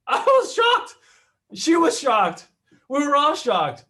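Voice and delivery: high, loud cries of shock. Real, not acted. Agent telling the class, loud and astonished. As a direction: exclaim it in disbelief. Retelling the seller's surprise.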